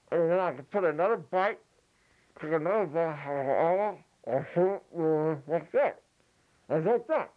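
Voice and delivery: talking through bagel; words round a mouthful; mouth full. Shown as a demonstration. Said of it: "ventriloquism while you're eating".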